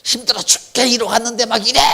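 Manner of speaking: high, irritated grumbling; a husband home from work, a hint of irritation; mock irritated whine. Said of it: "짜증을 내면서".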